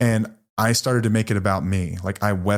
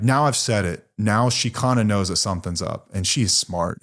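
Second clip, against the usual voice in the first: ordinary talk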